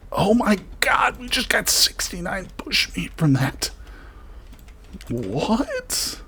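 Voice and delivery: high, airy excited squeal; thrilled disbelief. Real, not acted. Player getting a huge meat haul, thrilled squeal. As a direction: gush in astonishment at the windfall.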